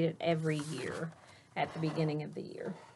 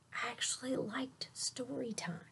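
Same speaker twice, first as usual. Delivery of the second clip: a soft unvoiced whisper; 5 semitones higher, 9 dB quieter than usual. Boy admits to the class he liked it, whispering. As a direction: confide it in a hush.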